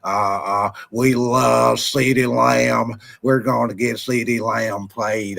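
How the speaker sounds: low, very slow halting owner voice; a deep, dragging mock press conference; Jerry Jones impression. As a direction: stretch every word like a rambling team owner. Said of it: "that's my old Jerry Jones impression".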